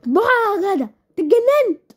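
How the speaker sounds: can't believe what he said; high loud shocked cry; shocked outburst. Acted. Wife shocked at husband.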